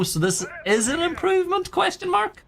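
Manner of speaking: high, rising ironic lilt; sarcastic. Real, not acted. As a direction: tease it as a fake compliment. Ironic praise of losing team.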